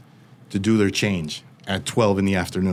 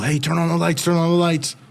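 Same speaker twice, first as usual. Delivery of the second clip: higher-pitched call from across the room; 6 semitones higher than usual. Retold or imitated. Inmates in the dark cell calling to the guards, higher calling voice. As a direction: shout it into the darkness, hurrying the guards.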